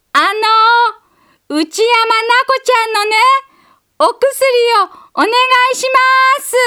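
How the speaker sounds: calling up to a closed window, high and loud; child calling loudly. A narrator's reading. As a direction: call out as hard as a little girl can. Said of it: "思いっきり大きな声を出した".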